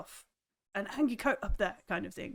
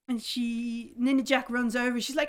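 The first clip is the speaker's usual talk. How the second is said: ordinary talk, nothing marked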